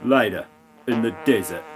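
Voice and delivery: ordinary delivery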